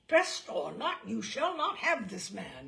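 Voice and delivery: loud, booming defiance; thundering. A narrator's reading. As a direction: thunder it at the devil himself. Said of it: "he thundered".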